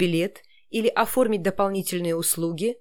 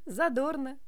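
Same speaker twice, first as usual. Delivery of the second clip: laughing, high playful reading; 6 semitones higher, 5 dB quieter than usual. Shown as a demonstration. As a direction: tease it with a laugh. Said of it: "Я могу читать".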